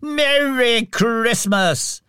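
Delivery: high, slow, jolly cheer; fat, jovial voice. A narrator's reading. Jolly employer toasts young Scrooge, jovially. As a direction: draw out the greeting heartily. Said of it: "jovial voice".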